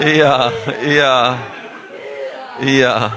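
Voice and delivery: loud braying I ja like a donkey. Retold or imitated. Preacher imitates the donkey in the well, loud braying. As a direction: bray the words like a donkey, high and loud. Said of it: "Jak on krzyczy?".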